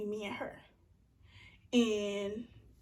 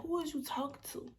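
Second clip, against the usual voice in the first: incredulous whisper; 6 semitones higher, 4 dB quieter than usual. Real, not acted. Indignant aside about her tone.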